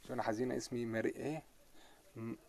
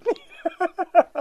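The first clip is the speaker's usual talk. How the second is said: high burst of laughter; 9 dB louder than usual. Real, not acted. Laughing after the rant.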